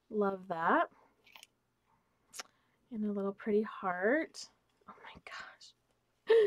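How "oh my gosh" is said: slow breathless whisper of delight; awed whisper. Real, not acted. Opening a gift from a friend, a slow awed whisper. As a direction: hush it out like you can't believe it. Unboxing a gift, hushed awe.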